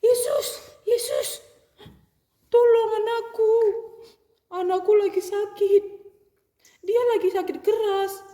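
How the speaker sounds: high, loud desperate father begging; father pleading for his daughter, high and loud. Acted. Desperate father begs Jesus.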